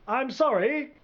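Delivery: high, soft faraway voice; small voice far off; distant reply. A narrator's reading. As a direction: answer faintly from across a field. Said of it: "came the distant reply".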